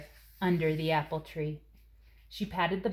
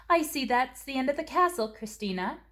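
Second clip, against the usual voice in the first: mother with a smile; 7 semitones higher than usual. Acted. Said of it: "she said with a smile".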